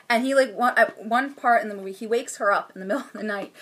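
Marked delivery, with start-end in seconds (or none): none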